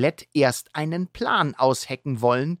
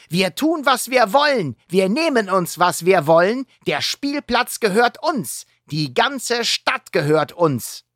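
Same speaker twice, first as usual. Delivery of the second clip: put-on boastful villain kid; 8 semitones higher, 4 dB louder than usual. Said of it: "sagt Rip".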